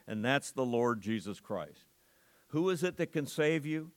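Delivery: ordinary talk, nothing marked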